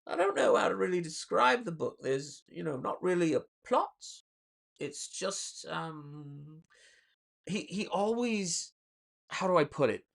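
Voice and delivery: high, hesitant put-on author voice; Neil Gaiman imitation. Streamer imitating an author struggling to describe a book, hesitant and high. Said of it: "you'd hear Neil Gaiman and he goes".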